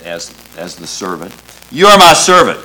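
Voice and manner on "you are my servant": loud, raised proclamation; preacher booming out a quote from God; emphatic divine declaration. Acted. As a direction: proclaim it as God's own word, high and forceful. Preacher voices God's declaration in scripture.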